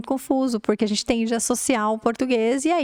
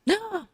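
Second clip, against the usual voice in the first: mock gasp; 10 semitones higher, 4 dB quieter than usual. Reacting to the word that.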